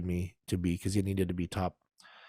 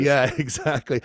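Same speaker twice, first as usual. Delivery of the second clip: ordinary delivery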